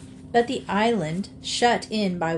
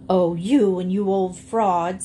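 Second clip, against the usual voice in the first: put-on louder, scornful jab at an old fraud; scornful scolding; 4 dB louder than usual. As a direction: call out the liar with contempt. Henrietta scolds the alligator.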